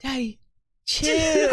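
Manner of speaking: high-pitched imitation of a child; little kid voice. Quoting the kid from that video, high imitated child voice. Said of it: "it sounded like a little kid".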